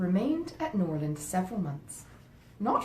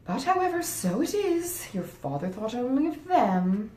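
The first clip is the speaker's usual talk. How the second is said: higher, louder and slow, with a pointed edge; indignant; 6 semitones higher, 7 dB louder than usual. Acted. Wife resenting the father's care for his widow, indignant. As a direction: land the complaint hard and deliberate.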